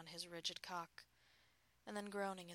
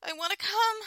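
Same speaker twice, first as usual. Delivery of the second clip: very high, very loud desperate cry; 18 dB louder than usual. A narrator's reading. Wife repeating her plea to her husband, crying out. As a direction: cry it out at full voice. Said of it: "I cried".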